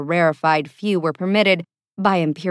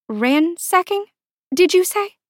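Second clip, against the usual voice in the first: high, rising disbelief; scandalised, high-pitched question; incredulous; 11 semitones higher than usual. A narrator's reading. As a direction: query it in shocked astonishment. Shocked echo of assistant's report.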